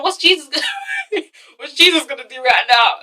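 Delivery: words breaking into a real laugh; laughing mockery. Real, not acted. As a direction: scoff through giggles. Laughs while mocking the plea.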